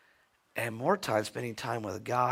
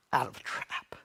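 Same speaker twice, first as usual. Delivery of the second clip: whispered; 4 dB quieter than usual. Real, not acted. Tender sermon climax.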